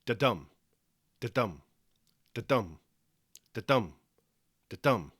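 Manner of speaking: "Da-dum" five times, each stressed on "dum"; evenly spaced "da-dum" pulses with pauses between; five heartbeats. Shown as a demonstration. Teacher beats out pentameter like a heart, "da-dum" stressed on "dum". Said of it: "a rhythm like five heartbeats".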